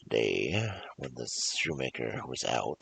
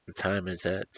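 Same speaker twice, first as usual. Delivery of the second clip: usual speaking voice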